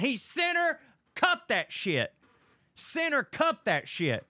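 high, drawn-out disbelief; amazed exclamation. Real, not acted. Storyteller reliving a friend's impossible putt, amazed. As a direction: stretch it out high, savoring every word.

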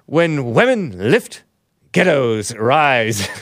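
"ghettos" in a slow mock Scottish accent; slow, lilting mock Scottish accent. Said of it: "in Scottish accent".

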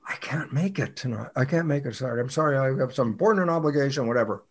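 very soft, half-whispered apology; hushed excuse. Acted. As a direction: beg off under your breath. Acted reluctant excuse to colleague.

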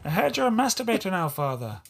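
jokey attempt at an Irish accent. To his co-host, a bad mock Irish accent. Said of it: "that was my Irish accent".

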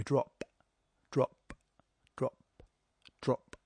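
each DROP isolated by a long pause; spaced out. Shown as a demonstration. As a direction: drip out "drop" like melting snow, one by one. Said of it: "spaces it all out".

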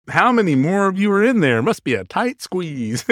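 mock Alabama accent, starting high, slowing at the end. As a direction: imitate the Alabama tourist's Southern accent. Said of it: "the Alabama guy is like".

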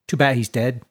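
plain reading voice

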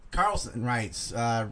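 usual speaking voice

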